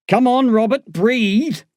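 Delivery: high, loud urging; hollering. A narrator's reading. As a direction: holler at a patient to breathe. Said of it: "hollers the oldest officer".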